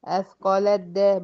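slow أثقلت د with no ت heard, lam straight into د; slow demo of ت merging into د; isolating the merge. Shown as a demonstration. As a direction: stretch أثقلت د, ت swallowed into the doubled د. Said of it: "بدخل من اللام على الدال".

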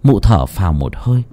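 plain reading voice

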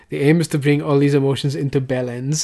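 "balance" said as "bell-ends" in a German accent; put-on German accent, stressed odd "balance"; weird German accent. Shown as a demonstration. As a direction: mimic a German presenter, "balance" sounding like "bellends". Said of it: "in a weird German accent".

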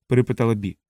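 ordinary delivery

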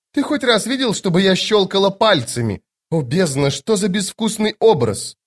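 high, offended lady's tirade; indignant. Acted. Lady Envy rebuking Toc for the witch remark, indignant. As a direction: scold in a high, affronted voice.

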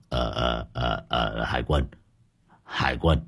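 put-on low, soft drunken mumble; drunk mumbling. A narrator's reading. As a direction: mumble it half asleep and drunk. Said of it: "lão u ớ".

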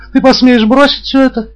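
very high, loud challenge; incredulous outburst. A narrator's reading. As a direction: hurl it at him in disbelief. Mistress challenges lover in fury. (высокий громкий вызов)

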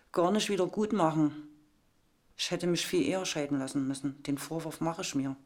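ordinary delivery

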